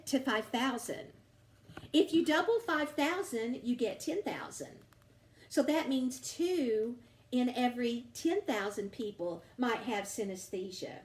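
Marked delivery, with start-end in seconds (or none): none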